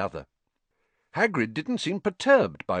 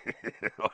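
laughing; 3 semitones higher, 6 dB quieter than usual. A narrator's reading. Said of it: "Hagrid was laughing".

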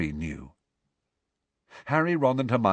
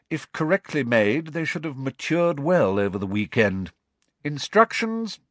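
higher-pitched, disdainful schoolmaster; sneering teacher; 6 semitones higher than usual. A narrator's reading. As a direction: instruct the class with cold disdain. Narrator voices Snape teaching.

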